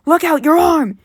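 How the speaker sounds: sudden shout of alarm. Retold or imitated. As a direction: yell the warning as the arm catches fire. Batman warns Gordon of fire.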